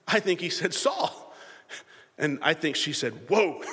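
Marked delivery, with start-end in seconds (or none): 0.7-1.2 very high, rising call of a name; Samuel calling out. Acted. Samuel calling Saul by name, high rising call. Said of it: "Samuel called him by name". 3.3-3.6 short mock-astonished exclamation; freaked-out surprise. Witch seeing Samuel appear, mock-startled exclamation. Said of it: "she's freaking out over it".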